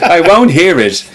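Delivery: hammed-up refusal to listen; mock indignant. Pretend royal fan shutting down criticism, slow mock huff.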